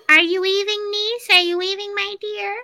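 high-pitched witchy auntie call; high witch call. Retold or imitated. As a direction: call up to the window in a high crone's voice. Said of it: "yells out to the window".

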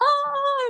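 very high squealing coo; imitating baby's delighted squeal. Mother showing how her baby smiles, high squeal. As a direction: mimic a baby's happy squeal. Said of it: "that's how she smiles".

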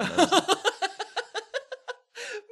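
can't stop laughing, high and loud; loud high laughter. Real, not acted. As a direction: burst into high shrieking laughter. Bursting out laughing.

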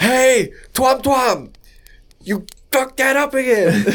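very high, loud scolding squeak; squeaky alien boss. Pretend. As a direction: scold in a shrill cartoon voice.

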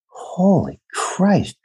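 high, slow, disgusted "what a creepy bunch"; creeped-out exclamation. Real, not acted. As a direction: draw it out, high and appalled. Horrified at the creepy scene.

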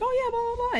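very high brush-off; sheepish high voice. Retold or imitated. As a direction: squeak it out awkwardly. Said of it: "And I'm like".

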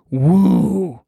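loud, drawn-out "woo" of a ship; long swooping ship noise; imitated takeoff whoosh. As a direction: imitate a spaceship taking off. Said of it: "She actually said it went".

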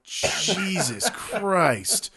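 drawn-out dismay. Retold or imitated. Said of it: "You're like".

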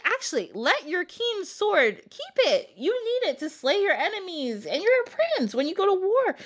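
sweetly steer him away from the sword; scheming stepmom. Retold or imitated. Stepmom voiced scheming about sword.

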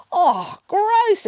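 put-on high-pitched childish disgust; disgusted exclamation. A narrator's reading. Children reacting to grandmother's story, disgusted exclamation. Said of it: "exclaimed the children".

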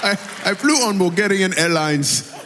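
playful Zimbabwean accent impression; guest's Zimbabwean accent. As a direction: parrot her back in a put-on Zimbabwean accent. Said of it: "I do that accent really well".

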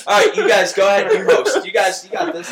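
loud, high, laughing protest; laughing mock surrender. Real, not acted. Teased host handing over to co-hosts, laughing exasperation. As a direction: give up through your own laughter.

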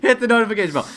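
high, loud, laughing YouTuber plug; YouTuber outro voice. Pretend. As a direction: hype the bell like a YouTuber.

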